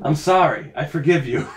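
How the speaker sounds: ordinary talk, nothing marked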